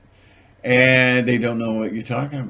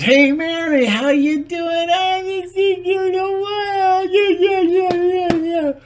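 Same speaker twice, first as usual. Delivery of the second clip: high sing-song greeting; 6 dB louder than usual. Retold or imitated. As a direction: gush like an overexcited friend. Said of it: "Jane goes".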